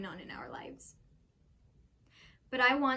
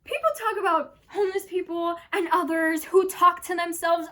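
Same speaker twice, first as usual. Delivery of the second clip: loud, high, worked-up ranting; manic storyteller; 6 semitones higher, 9 dB louder than usual. Acted. Character rants about the real lunatics, manic and amused. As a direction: rant loudly, ending in a laugh.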